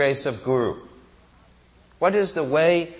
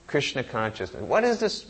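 usual speaking voice

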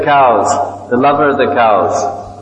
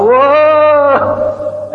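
gleeful whoa; 11 semitones higher than usual. Retold or imitated. Baby Krishna dragged by calf.

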